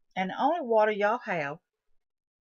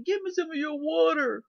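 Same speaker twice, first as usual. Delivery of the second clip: high, pleading whine; mock crying plea; 7 semitones higher than usual. Boyfriend begging the others for water, mock crying. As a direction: beg like a whining kid. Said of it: "he starts crying".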